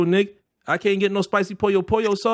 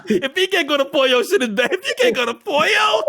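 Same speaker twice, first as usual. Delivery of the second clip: laughing shout; 9 semitones higher than usual. Real, not acted. Laughing over the chicken joke.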